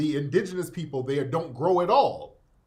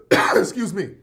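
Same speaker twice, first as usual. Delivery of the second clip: coughing through the words; choking on a drink; 4 dB louder than usual. Real, not acted. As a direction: voice caught by a cough. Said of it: "Choking and shit".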